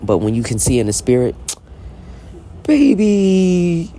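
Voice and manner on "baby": sassy. Real, not acted. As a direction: toss it off with sass. Sassy aside.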